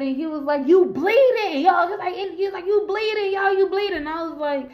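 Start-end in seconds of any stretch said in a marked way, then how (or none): 0.7-1.9 high, loud exclamation of alarm; retold alarm, pitched right up. Boyfriend notices she is bleeding. 2.7-4.0 high-pitched insistent alarm; retold alarm, voice pitched up. Boyfriend repeats his alarm.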